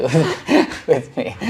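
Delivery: amused laughter at being named; laughing. Real, not acted. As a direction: giggle through the words. Laughing, she learned with him.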